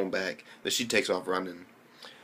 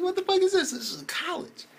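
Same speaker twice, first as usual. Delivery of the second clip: baffled outcry. Retold or imitated. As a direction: cry out in disbelief, then trail off. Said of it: "I'm like".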